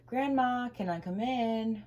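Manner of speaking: high, loud, slow call; calling through the door. Acted. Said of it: "she called".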